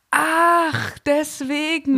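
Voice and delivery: high surprised "aha"; a penny-drop moment; sudden realization. Retold or imitated. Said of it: "dachte ich dann".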